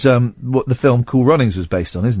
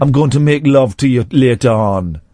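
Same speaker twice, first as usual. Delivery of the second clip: mock Jamaican lothario accent; 3 semitones lower than usual. Presenter playing seducer to his co-host, mock Jamaican accent. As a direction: chat her up in a hammed-up Jamaican accent. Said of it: "that doesn't make you a Jamaican".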